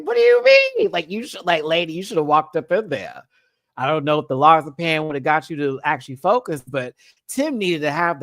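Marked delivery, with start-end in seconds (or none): 0.0-3.2 high, loud mock exasperated mom. Quoting the mom scolding Tim, high and exasperated. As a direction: play the fed-up mom, pitch way up. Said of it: "And the mom's like".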